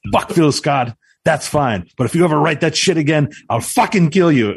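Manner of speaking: high, loud, angry bad French accent. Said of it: "I'm doing a very bad French accent".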